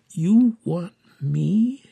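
slow, wondering question; amazed. A narrator's reading. Young woman asks the children if she's wanted, amazed. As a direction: draw the words out in amazement.

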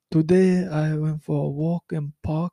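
slow, put-on French accent; halting mock French accent. Shown as a demonstration. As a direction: pick through it slowly in a French accent.

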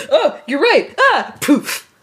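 high, loud comic alarm; mock startled realisation. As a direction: yelp in sudden realisation, then go poof. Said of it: "being like".

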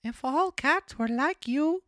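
high puppet voice, drawn out and loud; marionette voice. A narrator's reading. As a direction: gush to the cat in a high marionette voice. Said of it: "whispered in the cat's ear".

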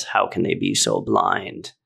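dropped way low, mocking the preachy ones. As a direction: say it low and scoffing like a know-it-all. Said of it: "how many times have you heard this".

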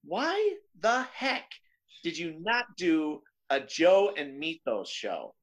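acting out baffled fans, spaced-out words; exasperated demand. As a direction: demand an answer word by word. Voicing exasperated fans' demand.

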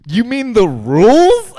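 drawn-out, high incredulous yell; imitating Mike's yell. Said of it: "and then he just yells out".

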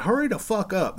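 high-pitched imitation of a loud woman; mock impatient yell. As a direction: holler it like an impatient partner, retold. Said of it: "they're both very loud people".

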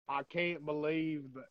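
put-on failed American accent sliding into Canadian. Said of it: "freaking Canadian accent".

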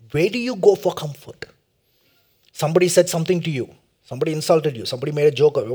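ordinary talk, nothing marked

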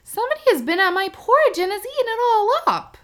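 put-on tiny baby bear, upset about his porridge; high wee voice of the littlest bear; small wee voice. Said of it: "in a small wee voice".

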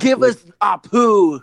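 loud, drawn-out mock demand. Voicing Disney demanding a cartoon character, loud slow mock demand. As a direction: call it out slowly like a joke ultimatum.

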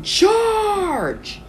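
very high, drawn-out rallying scream; screamed battle cry. Pretend. Game master leading the team into battle, screamed. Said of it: "run and scream".

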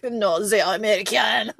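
put-on comic French accent; mock French accent, laughing at the end. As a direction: imitate a French roommate correcting someone. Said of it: "the French was like".

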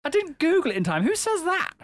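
high-pitched disbelief, cracking up; squeaky self-mocking incredulity; incredulous at oneself. Real, not acted. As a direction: repeat it high, amused at your own words. Repeats own admission in disbelief.

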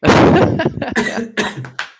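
big burst of high laughter; loud laugh. Real, not acted. Laughing at the ice cream joke.

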